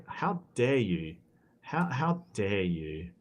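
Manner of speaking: slow, quiet dramatic indignation; drawn-out theatrical how dare you; mock outrage. Mock outrage at time zone.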